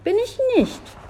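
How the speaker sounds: put-on very high, childish protest; squeaky, cross little-boy voice; cross denial. A narrator's reading. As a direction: contradict like an angry little boy. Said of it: "widersprach Olli böse".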